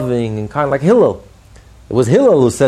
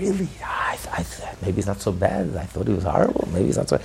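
half-whispered, much softer, thinking aloud; hushed musing; 3 semitones lower, 6 dB quieter than usual. Retold or imitated. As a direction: mull it over under your breath. Second man reconsidering, hushed.

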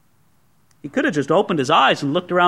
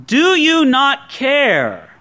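slow, with weight on "Do"; reproachful question; 3 semitones higher, much slower than usual. Retold or imitated. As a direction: drag out "Do you not care?" as a complaint. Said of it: "don't necessarily say what the disciples said".

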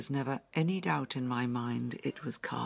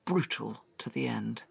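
ordinary talk

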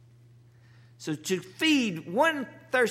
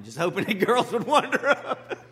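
laughing through the words, a bit louder; chuckling at his own joke; 5 semitones higher, 8 dB louder than usual. Real, not acted. Pastor laughing at his seminary days.

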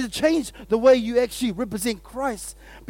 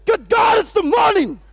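shouted, much higher and louder, bursting with energy; excited morning shout; 11 semitones higher, 6 dB louder than usual. Shown as a demonstration. Pastor showing the right way to greet the morning, joyful shout. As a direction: greet the day like a victory. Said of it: "You say".

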